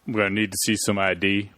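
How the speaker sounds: gruff doorman demanding ID; bouncer voice. Acted. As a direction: play a stern club bouncer. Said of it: "That's my bouncer voice".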